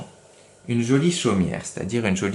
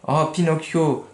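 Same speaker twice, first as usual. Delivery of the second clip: tearful; 6 semitones higher than usual. Acted. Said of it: "avec des pleurs dans la voix".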